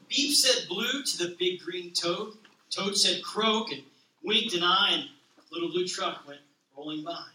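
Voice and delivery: gentle, even picture-book read-aloud; reading to children. A narrator's reading. Pastor reading a children's book to the congregation, gentle read-aloud. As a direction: read it like a bedtime story to a toddler.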